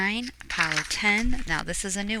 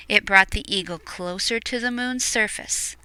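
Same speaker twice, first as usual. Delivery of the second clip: ordinary delivery, nothing marked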